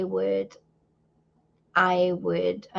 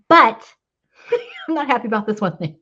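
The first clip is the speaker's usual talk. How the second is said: laughing through tears, words tumbling out fast; half cry, half laugh as she admits it; teary laugh; 5 dB louder, faster than usual. Real, not acted. Admitting her complaint to God, tearful laughing rush.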